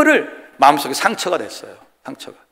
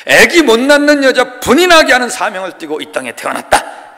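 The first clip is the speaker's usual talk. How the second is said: mock charter recitation; 7 semitones higher, 5 dB louder than usual.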